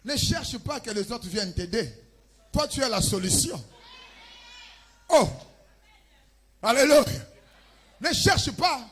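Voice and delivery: loud, high-pitched exhortation; full-force preaching. Acted. Preacher urging listeners to be the family's solution, forceful preaching. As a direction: preach it out loudly to the whole congregation.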